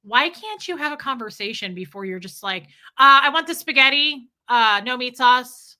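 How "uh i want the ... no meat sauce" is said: loud, blunt food order; mock rude diner, much louder; rude customer. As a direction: bark the order with no courtesy. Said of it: "be rude to people".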